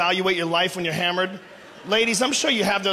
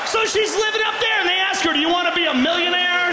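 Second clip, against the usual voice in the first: high excited rant; 8 semitones higher than usual. Real, not acted.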